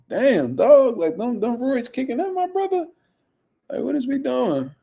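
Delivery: high, squeaky incredulous voice; mock incredulous reaction. Streamer teasing the screaming fighter, high and baffled. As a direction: pitch way up, baffled at the roid rage. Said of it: "I was like".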